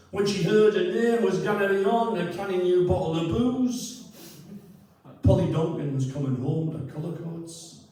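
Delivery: hammed-up Newcastle accent, reciting the rhyme; rubbish Newcastle accent. Reading a daft verse for the audience in a rough Newcastle accent. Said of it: "I'm sorry for the rubbish Newcastle accent".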